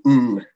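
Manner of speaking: ng held in the nose, no g after it; the ng at the end of sing, said alone; ng sound. Shown as a demonstration. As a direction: hum the ng from the back of the mouth. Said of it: "get rid of the first part".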